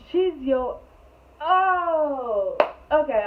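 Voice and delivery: shocked outburst. Real, not acted. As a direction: catch yourself mid-sentence in shock. Learning he brought his ex.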